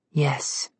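breathy whispered yes; airy whisper. A narrator's reading. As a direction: breathe the word as a spirit. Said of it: "an airy voice".

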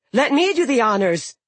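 high, loud eager giantess; a bloodthirsty sea giantess volunteering. Acted. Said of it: "said another daughter".

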